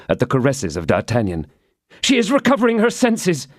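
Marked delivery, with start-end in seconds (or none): 2.0-3.5 relieved exclaim. Acted. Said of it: "exclaimed the young man".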